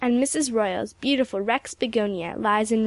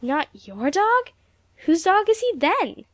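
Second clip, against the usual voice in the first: shocked; 8 semitones higher, 4 dB louder than usual. A narrator's reading. Shocked reply.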